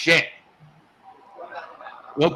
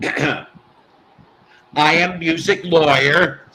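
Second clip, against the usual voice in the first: slow, drawn-out announcer-style catchphrase; catchphrase delivery; 3 semitones lower, 4 dB louder, slower than usual. Shown as a demonstration. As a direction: deliver it like a grand title. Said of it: "he came up with how I say this".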